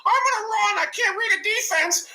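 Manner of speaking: high, loud, whiny mocking voice; whiny mock Lamar. Mocking Lamar's scrambling, in a high whiny voice. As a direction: whine it like a panicked kid. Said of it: "he go".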